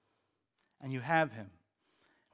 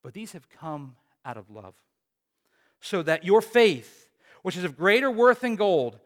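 louder, building scripture reading; preaching scripture; 3 semitones higher, 7 dB louder than usual. Acted. Preacher reading Peter's letter to the congregation, proclaiming it loud. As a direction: proclaim the passage to the church.

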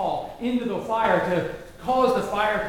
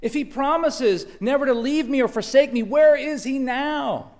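raised, louder demands for an answer; angry questioning; 4 semitones higher, 5 dB louder than usual. Acted. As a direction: challenge God in frustration. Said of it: "we find ourselves angry".

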